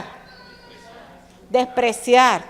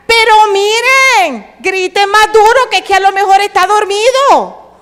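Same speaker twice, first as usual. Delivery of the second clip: mocking sarcasm; 8 semitones higher, 8 dB louder than usual. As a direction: jeer at them like Elijah on Carmel. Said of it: "Por ejemplo". (burla sarcástica, fuerte y aguda)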